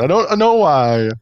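drawn-out bad Italian accent; fake Italian accent. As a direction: play it as cartoon Italian. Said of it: "when you do the fake Italian accent".

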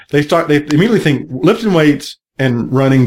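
ordinary talk

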